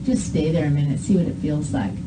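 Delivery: soft. Real, not acted. Coaching child to hold mm.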